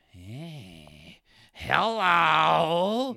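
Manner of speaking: slow, eerie, drawn-out ghostly taunt; creepy ghost voice. Retold or imitated. Retelling the ghost's threat in a slow eerie voice. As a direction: drag it out like a possessed man. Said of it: "the guy's like".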